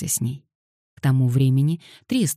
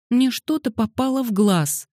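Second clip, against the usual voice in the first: put-on higher, whimpering little girl; whining; 9 semitones higher than usual. Girl whining to the boys about her eye. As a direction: whine and rub the eyes. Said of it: "она захныкала".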